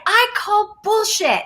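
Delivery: high, slow, blunt callout; blunt therapist. Retold or imitated. Therapist calling her bluff in session, high and slow. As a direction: call her bluff point-blank. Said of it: "he's like".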